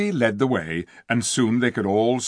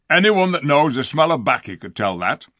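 louder, higher rough dwarf scorn; scornful dwarf; 4 semitones higher, 6 dB louder than usual. Acted. Diggle scolding Edmund about the dark stable, loud and scornful. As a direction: spit out the insults.

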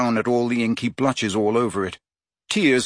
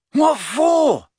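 high, loud disbelieving demand; incredulous; 5 dB louder than usual. A narrator's reading. Boy hears the potion is being saved, incredulous. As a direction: burst out in sheer disbelief. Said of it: "demanded Ron incredulously".

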